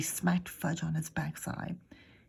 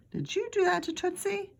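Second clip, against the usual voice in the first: put-on child voice; 13 semitones higher than usual. Mother questions child, child answers.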